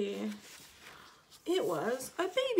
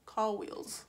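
plain reading voice

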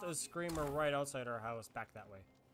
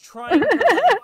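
excited; 13 semitones higher, 18 dB louder, much slower than usual. Real, not acted. Excited game retelling.

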